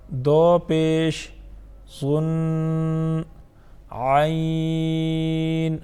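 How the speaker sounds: drawn-out monotone letter chanting; measured teacher's recitation, syllables sustained; Qaida recitation drill. Shown as a demonstration. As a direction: hold each letter long on one even note.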